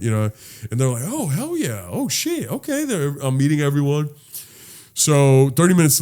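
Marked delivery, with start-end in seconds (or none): 1.0-2.9 high, impressed reaction without raising volume; imitated approval. Relatives learning he is the groom's friend, imitated approval. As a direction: mimic impressed relatives, voice lifted high and light.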